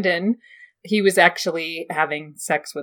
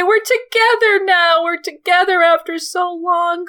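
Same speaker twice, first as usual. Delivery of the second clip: high, loud, tearful and pleading, slowing at times; a heartbroken woman unravelling; hysterical jealous aunt; 11 semitones higher, 4 dB louder than usual. Acted. Lysa to Littlefinger about his kiss, high tearful pleading. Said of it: "She sobbed hysterically".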